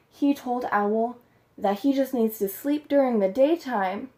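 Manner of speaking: usual speaking voice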